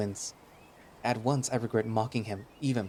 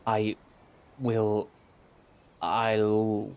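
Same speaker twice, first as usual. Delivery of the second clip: halting "I", "will", then a drawn-out "I'll"; practising contractions; much slower than usual. Acted. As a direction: labour from "I will" into "I'll", with pauses. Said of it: "I will work on it".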